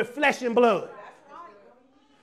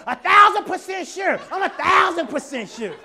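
louder, higher mock shout of certainty; hammed-up talk show certainty; 5 semitones higher, 8 dB louder than usual. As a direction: bellow it like an outraged guest. Mocking guest's certainty.

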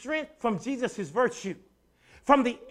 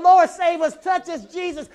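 crowd begging; 8 semitones higher, 10 dB louder than usual. Retold or imitated. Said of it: "People was reaching out".

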